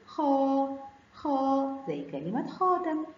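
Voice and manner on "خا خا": خا with the aa drawn out; long madd vowel. Shown as a demonstration. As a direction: hold each خا as a long syllable. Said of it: "خاء فتحة ومد بالألف".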